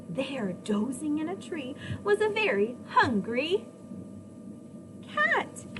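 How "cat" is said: ordinary delivery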